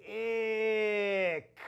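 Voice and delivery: "P-ick" stretched out slowly, sounds pulled apart; "P-ick" said in slow motion, each phoneme drawn out. Shown as a demonstration. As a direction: segment it slowly for the kids. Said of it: "let's go slow motion".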